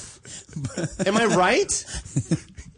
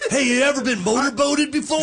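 high, loud, laughing mock come-on; mocking host impression; 9 semitones higher, 5 dB louder than usual. Mocking the host's rush at the guest, loud crass impression. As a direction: play the overeager host at full tilt. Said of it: "buries his face in it".

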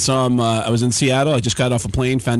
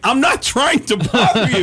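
high, imitated defensive plea; pleading protest; 8 semitones higher than usual. Impressionist defends himself to annoyed actor, pleading protest. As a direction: protest your innocence, pitched up.

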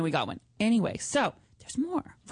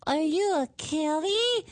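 put-on slow, higher sing-song baby talk to a kitty; cutesy voice for talking to animals; pet-talk voice; 5 semitones higher, slower than usual. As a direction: coo at the stray cat like it's a puppy. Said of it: "my voice is annoying talking to dogs".

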